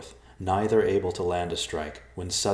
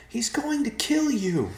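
high, slow, desperate cry; drawn-out, piercing cry of alarm; called-out warning; 4 dB louder, slower than usual. A narrator's reading. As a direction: call out a warning in panic. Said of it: "she called out".

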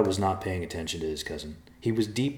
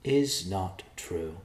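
very slow, each word weighted; with emphasis; much slower than usual. Acted. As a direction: lean on every word. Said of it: "the mouse said with emphasis".

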